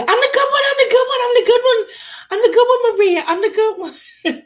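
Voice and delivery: high, frantic pleading on repeat; hammed-up terrified begging, breaking into laughter; panicked friend. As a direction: squeal it over and over to save yourself. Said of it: "And my friend would go".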